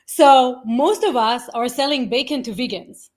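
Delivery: ordinary talk, nothing marked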